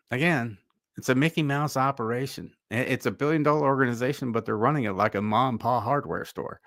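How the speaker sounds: ordinary delivery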